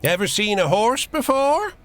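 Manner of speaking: put-on high, loud old-timer twang; a salty old driver showing off; gruff old fisherman. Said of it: "The salty old driver grins".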